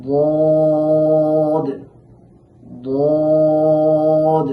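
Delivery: long sung Daad, held steady; Arabic letter demo. Shown as a demonstration. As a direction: chant বদ slowly like Quran recitation. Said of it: "লাগাইয়া উচ্চারণ করতে হবে". (টেনে সুরে বদ উচ্চারণ)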